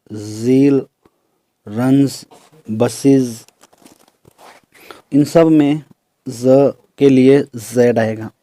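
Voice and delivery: ordinary talk, nothing marked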